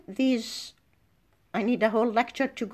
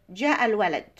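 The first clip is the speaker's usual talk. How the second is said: جاء الولد with the end dropping; falling statement intonation; much faster than usual. Shown as a demonstration. Teacher showing an Arabic statement, جاء الولد with falling end. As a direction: let الولد fall away as a statement. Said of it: "The end of the statement is falling".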